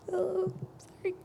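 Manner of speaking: breaking down for a moment, apologizing; real tears. Real, not acted. Tearing up during toast.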